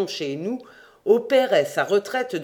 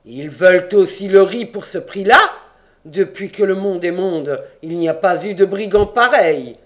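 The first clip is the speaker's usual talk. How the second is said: loud, indignant outburst; roared; 2 semitones higher, 8 dB louder than usual. A narrator's reading. Abraham rages at the buyers' price, roaring. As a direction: thunder it in outrage. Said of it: "Rugit Abraham".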